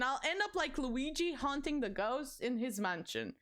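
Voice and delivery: usual speaking voice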